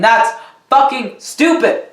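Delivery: a real flash of annoyance, drawn out; exasperated outburst. Real, not acted. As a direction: spit it out in disbelief at the film. Ranting about Luke's return.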